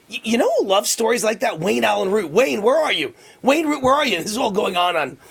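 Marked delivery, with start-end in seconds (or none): 0.1-4.2 imitating Trump calling out. Host retells the president seeking him in the crowd, calling out. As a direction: act out a speaker calling for someone mid-speech. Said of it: "all of a sudden he's like".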